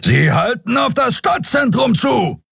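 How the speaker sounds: high, urgent captain's roar. A narrator's reading. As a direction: bellow it across the bridge. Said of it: "brüllte Kapitän Sron". (Kapitän brüllt zur Brücke, hoch und drängend)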